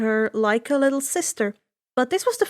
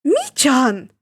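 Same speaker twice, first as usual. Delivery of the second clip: sharp call; 5 dB louder than usual. Acted. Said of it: "Inko's voice cut through everything".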